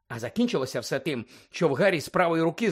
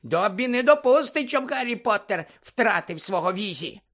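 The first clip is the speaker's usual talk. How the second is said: Dobby the house-elf squeaking at Harry; squeaky house-elf; 5 semitones higher than usual. Acted. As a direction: squeak it like an anxious little elf.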